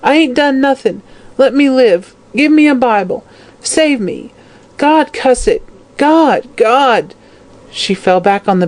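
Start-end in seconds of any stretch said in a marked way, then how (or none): 0.0-7.1 very high, pleading wail; terrified old woman's acted pleas; whimpering. A narrator's reading. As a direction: beg pitifully in a high voice, as if dying.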